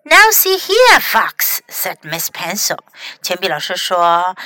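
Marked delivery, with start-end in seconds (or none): 0.0-1.6 higher, louder opening that drops on the name; mildly cross storybook librarian; stern scolding. A narrator's reading. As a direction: call a pupil sharply to order.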